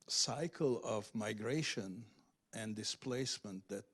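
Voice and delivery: ordinary delivery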